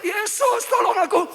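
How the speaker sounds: high shout building to a loud peak; Bartimeus. Acted. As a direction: keep crying out for help. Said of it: "dia tetap terus teriak". (teriakan minta tolong yang melengking)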